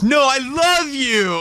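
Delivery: high, slow lovesick whine; Wayne's World lovesick. Retold or imitated. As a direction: gush it out like a smitten dork. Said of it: "like the guy from Wayne's World".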